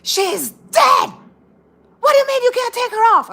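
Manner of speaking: imitating a furious, high-pitched shout; angry yell. Old man yells at the clerk about his late wife, furious. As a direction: explode at the clerk, very loud. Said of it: "he yelled, and he goes".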